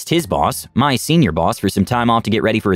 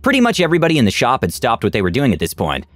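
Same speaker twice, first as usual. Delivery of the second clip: ordinary delivery, nothing marked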